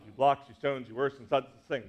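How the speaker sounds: meter beats marked on each stress; five stressed beats. Shown as a demonstration. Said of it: "That's five in a line".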